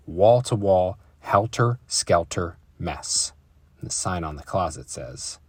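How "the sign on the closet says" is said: ordinary delivery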